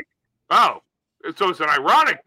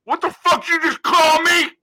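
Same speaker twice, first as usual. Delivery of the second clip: mad gunslinger; 9 semitones higher, 5 dB louder than usual. Acted. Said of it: "that's always mad".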